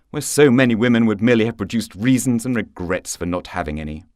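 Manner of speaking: ordinary delivery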